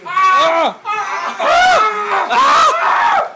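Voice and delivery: loud high yell; yelling out in hype; excited shout. Real, not acted. Reacting to a big move.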